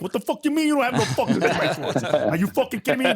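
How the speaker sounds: high-pitched, half-laughing mock rant; hammed-up hothead tirade, voice jumping high; excited mock outrage. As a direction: play up an indignant blow-up. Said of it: "we never got excited like that".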